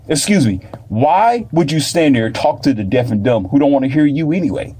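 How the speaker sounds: ordinary talk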